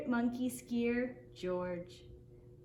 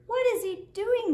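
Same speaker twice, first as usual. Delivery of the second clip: high, louder amazed question; 9 semitones higher, 6 dB louder than usual. A narrator's reading. Crowd amazed at the sledding monkey, high surprised question. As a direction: ask it wide-eyed and loud.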